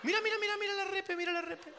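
hammed-up high-pitched taunt; mock high squeal. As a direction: squeak it like a giddy fan. Mocking crowd member.

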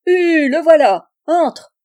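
put-on high, loud old man's shout; the old fisherman hollers for his guest to come in; yelled welcome. A narrator's reading. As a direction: bellow a welcome to the visitor. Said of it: "hurla Jean-Marie".

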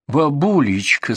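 slow, sugary coaxing; sweet voice. Acted. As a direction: coo sweetly at the old patient. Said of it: "сладким голосом произнесла Рита".

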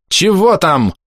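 high-pitched shouted question; крик. A narrator's reading. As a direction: call in impatiently. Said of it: "закричал, спрашивая доктор". (крик-вопрос высоким голосом)

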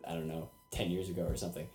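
ordinary talk, nothing marked

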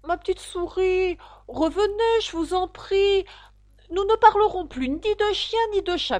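high, sweet pleading call; gentle calling. A narrator's reading. Alice calls the fleeing mouse back, gentle calling. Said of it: "Alice la rappela doucement".

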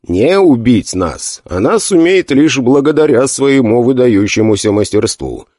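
plain reading voice

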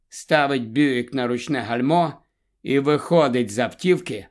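plain reading voice

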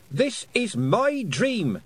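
high, drawn-out defiant cry; angry shout. A narrator's reading. Angry girl challenging the sky, high defiant shout. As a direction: declare it slowly, high and indignant. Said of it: "she shouted at the sky".

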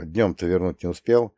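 usual speaking voice

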